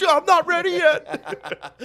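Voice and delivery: high, slow whine through laughter; hammed-up high whine; whiny kid. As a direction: whine like a caught teenager. Retold whiny reply to dad.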